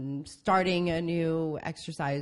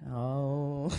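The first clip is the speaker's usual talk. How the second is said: low sultry sigh; a lower, seductive little "oh"; sexy voice; 4 semitones lower than usual. Pretend. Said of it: "very sexy voice there".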